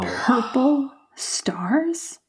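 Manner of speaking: slow, disbelieving whispered echo; incredulous whisper. Acted. Wife incredulous at husband.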